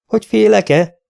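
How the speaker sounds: high, loud incredulous question; mocking disbelief; scornful retort. A narrator's reading. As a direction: throw the question back in disbelief. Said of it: "kérdezett vissza Aerion".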